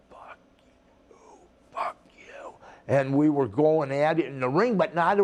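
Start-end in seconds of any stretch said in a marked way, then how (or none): 0.1-2.5 whispered curse. Retold or imitated. Retorting in the ring.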